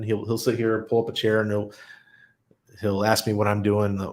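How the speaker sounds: usual speaking voice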